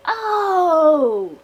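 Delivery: high, drawn-out falling "Oh!"; in-character exclamation. Acted. Player redoing the reaction as the character, high cry. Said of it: "I'm gonna do that in character".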